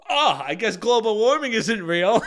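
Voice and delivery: loud, high mock heckle from the back row; sarcastic heckler. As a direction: call it out like a smug passenger joking aloud. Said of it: "there's a guy in the back that goes".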